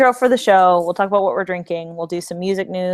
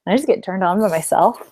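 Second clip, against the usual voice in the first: usual speaking voice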